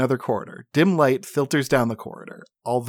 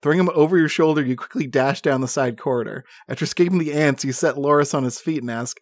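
ordinary talk

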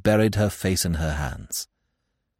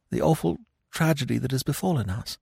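softer, voice breaking; 4 semitones higher, 6 dB quieter than usual. Acted. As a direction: let the voice crack. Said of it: "His voice broke".

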